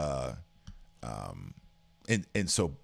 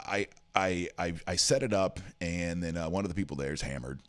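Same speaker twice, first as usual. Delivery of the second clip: usual speaking voice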